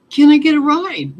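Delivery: high, loud cheeky demand; pushy loud ask. Retold or imitated. Young man in the car calling to the girl, loud and pushy. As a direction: shout it up at the rider like a dare. Passenger pushes for a ride.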